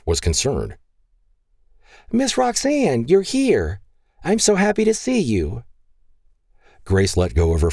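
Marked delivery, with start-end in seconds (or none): 2.1-5.6 put-on high, excited child's voice; little girl voice. Grace greeting Roxanne as she arrives, high excited child. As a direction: squeal it like a happy little girl.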